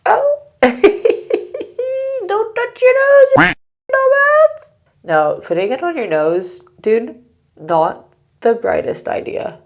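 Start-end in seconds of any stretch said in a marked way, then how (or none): 0.0-4.7 shrill squeal, then loud scolding; high yelling. Real, not acted. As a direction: yell at yourself in high alarm. Scolding herself for touching nose.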